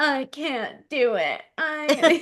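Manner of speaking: slow, high whiny complaining; whiny Joe Dispenza. Retold or imitated. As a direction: whine it like a worn-out student. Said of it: "does it in, like, the whiny voice".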